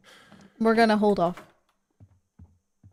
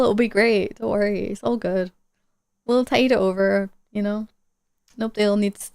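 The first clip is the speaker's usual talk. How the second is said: normal talking voice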